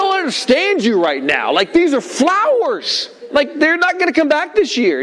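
high, slow, baffled exasperation; irritated. Retold or imitated. Father to little boy about ruined flowers, irritated mock lecture. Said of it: "I got so irritated".

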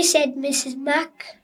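ordinary talk, nothing marked

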